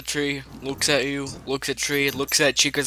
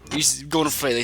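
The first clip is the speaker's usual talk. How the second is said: ordinary talk, nothing marked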